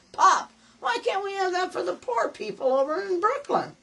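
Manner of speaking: put-on a kid pleading high with his father for a show; pleading young son. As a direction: beg your pop like a hopeful kid. Boy begging his father.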